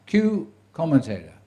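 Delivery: mock director yelling the cue into the headset; retold shout. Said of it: "And then he shouted".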